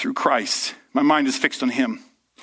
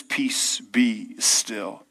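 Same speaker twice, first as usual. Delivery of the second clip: whispered command; 4 semitones lower, 3 dB quieter, much slower than usual. Retold or imitated. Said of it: "with the power of God declares".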